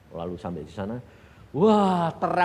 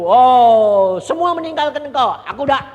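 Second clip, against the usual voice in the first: boastful Peter; 10 semitones higher, 15 dB louder than usual. Acted. Peter boasting in retold scene.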